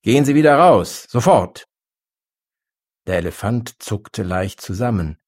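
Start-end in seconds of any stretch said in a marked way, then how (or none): 0.0-1.7 loud, higher-pitched rude order. A narrator's reading. Shopkeeper throwing the elephant out, rude tone. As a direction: order them out rudely, at full voice. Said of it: "Er staunte über den rüden Ton".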